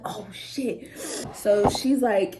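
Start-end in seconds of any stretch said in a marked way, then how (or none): 0.0-0.9 muttered curse. Retold or imitated. Realizing she smells of weed.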